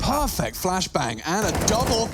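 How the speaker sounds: rising, drawn-out cry on the triple kill; excited play-by-play. Real, not acted. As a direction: build to a big, high hype call. Casting a triple kill live.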